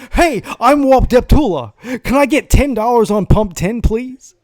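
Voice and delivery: high, loud mock radio-DJ voice; hammed-up booming announcer ordering gas; mocking the radio host's voice at the gas station; Walt Deptula impression. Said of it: "Nobody fucking talks like that".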